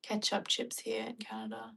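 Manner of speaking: soft whispered read. Real, not acted. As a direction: whisper it close to the mic. Whispering while reading comments.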